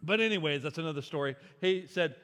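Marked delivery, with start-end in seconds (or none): none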